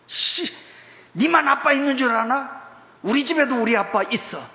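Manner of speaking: high whiny kid's retort, lightly acted; sulky crying child. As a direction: mutter back like a beaten kid walking off. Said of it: "울면서 가요". (울면서 투덜대는 아이 흉내)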